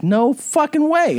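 drawn-out, high retold disbelief; incredulous, slow recalled reaction; stunned disbelief. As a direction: stretch it out high, reliving the surprise. Retelling stunned reaction to news.

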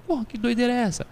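high, amazed disbelief, mock astonished; amazed foreigner. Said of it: "o cara fala assim". (espanto imitado, voz aguda)

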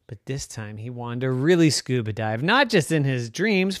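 ordinary delivery, nothing marked

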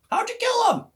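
Kenny voiced. Kenny voiced in retold hunt story.

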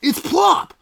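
very high, loud brand name cry; pitchman brand shout. Acted. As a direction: belt the product name like an ad. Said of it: "I got a commercial coming up".

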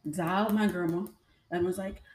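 ordinary talk, nothing marked

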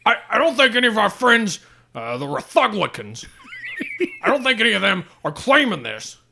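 high, hammed-up pundit, laughing through. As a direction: lampoon a self-righteous talk show host. Mock pundit, much higher.

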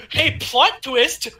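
high, excited disbelief; spring the twist with rising astonishment; giddy, high-pitched incredulity; amazed announcement. Acted. Mock amazement at plot twist.

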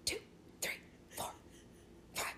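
forceful whispered counting, spaced out; imitated kid's intense whisper; aggressive whisper. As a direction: whisper each number sharply on its own. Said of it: "aggressively whispering, counting".

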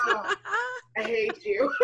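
high, laughing fake whine; mock wounded mom. Mom to son who outgrew her, mock wounded. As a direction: play hurt through a laugh.

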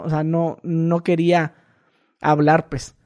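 ordinary talk

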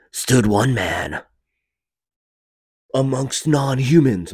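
loud, slow, dramatic trailer narrator; movie trailer voice. Acted. Trailer guy introduces the hero, in movie trailer voice. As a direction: boom out the hero's setup with long pauses. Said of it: "The movie trailer voice?".

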